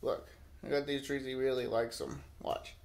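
normal talking voice

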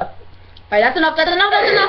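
rising protest. Real, not acted. Building protest over candy.

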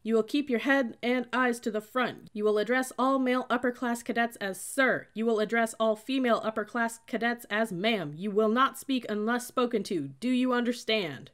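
loud, high drill-instructor delivery; barked rules. A narrator's reading. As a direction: lay down the rules in a military shout. Cadet shouting rules at recruits.

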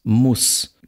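"muss" with a short open u; short lax vowel. Shown as a demonstration. Lecturer reads minimal pairs, short lax vowel. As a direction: clip the u in "muss".

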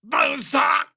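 strained high shout to teammates; downed cry. Acted. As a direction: cry out that you are down. Calling out being downed in combat. (切羽詰まった叫び)

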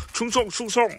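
high, loud put-on follower voice; pet's loyal salute. Pets pledging loyalty to their boss, high eager voice. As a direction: pipe up with eager mock loyalty.

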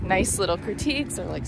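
ordinary talk

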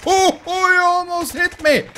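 high, loud outburst of alarm; startled shout. Real, not acted. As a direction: shout at a near miss. Nearly hit in the game.

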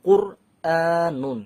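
কুরআনুন said very slowly with a full rounded r; slow Quranic demo of কুরআনুন; heavy rounded r. Shown as a demonstration. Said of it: "এখানে automatically মুখটা গোল হয়ে যাচ্ছে".

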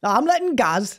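careful copied Geordie accent; good Geordie attempt. Shown as a demonstration. Repeating after the coach, careful Geordie accent attempt. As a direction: echo the coach's Geordie chunk. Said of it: "That was good".

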